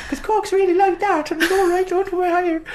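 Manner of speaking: very high sing-song Cork accent. Shown as a demonstration. Co-host showing off an Irish accent on request, high Cork accent. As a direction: put on a squeaky, high-pitched Cork lilt. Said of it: "I can do Cork".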